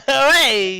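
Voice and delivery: high, loud hey; mock outrage. Real, not acted. Player scolds a friend's bum joke, loud mock outrage. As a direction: playfully scold the joker.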